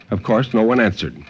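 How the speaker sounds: ordinary delivery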